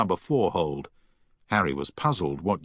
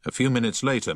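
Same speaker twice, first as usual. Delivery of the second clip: ordinary delivery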